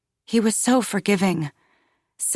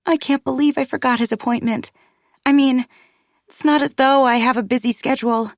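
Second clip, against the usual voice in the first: higher, flustered rush of words; flustered, self-reproaching; 6 semitones higher, faster than usual. Acted. Embarrassed mother confessing to Paul, flustered. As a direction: scold yourself, pitched up and agitated.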